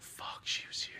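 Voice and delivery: hushed whisper of the dad; whispered inner thought. Retold or imitated. Voicing the dad's hidden thought, whispered. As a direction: whisper it like a secret to yourself.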